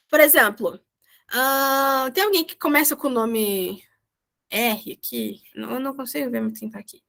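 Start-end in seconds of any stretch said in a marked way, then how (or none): none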